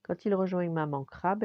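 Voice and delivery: ordinary delivery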